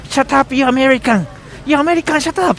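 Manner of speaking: high-pitched imitation of hostile gamers; mock angry teammates. Teammates silencing him on Overwatch mic, mock angry. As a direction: imitate players snapping over voice chat, high.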